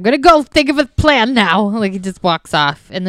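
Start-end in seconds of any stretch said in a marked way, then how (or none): 0.0-1.6 louder and higher mock huff; mock angry exit line. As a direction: storm off in a huff. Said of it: "he gets so mad".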